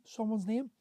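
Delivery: normal talking voice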